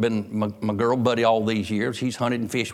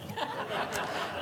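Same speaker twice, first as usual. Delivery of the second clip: real breathless laugh; silent, airy burst of laughter; breathy laugh; 11 semitones higher, 12 dB quieter than usual. Real, not acted. Preacher laughing at his son's nightlight idea, real soft laugh.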